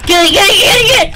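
high, urgent shouted pleading; rushing in panic; frantic. Real, not acted. Player racing to grab item, frantic plea.

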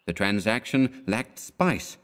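ordinary delivery, nothing marked

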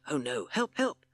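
scared witless, fleeing; Hissing Sid fleeing in fright; panicked cry for help. Acted.

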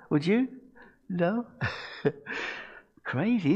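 normal talking voice

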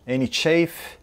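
ordinary talk, nothing marked

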